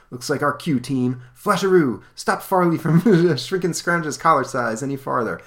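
rally the team, a touch of comic-book bravado; heroic boy leader. Acted. Robin sends Flasheroo into action, lightly voiced heroic call.

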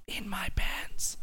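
whispered aside; whispering. Pretend. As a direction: breathe it, high and slow.

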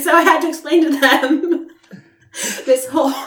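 loud, high talk through real laughter; real embarrassed laughter in the voice; laughing. Real, not acted. Embarrassed storyteller laughing at herself.

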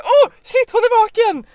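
high, loud gasp of surprise; startled relief. Retold or imitated. Stranger sees the fallen woman wake, loud and surprised. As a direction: blurt it out as she opens her eyes.

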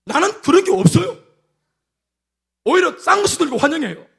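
emphatic high exclaim. Real, not acted.

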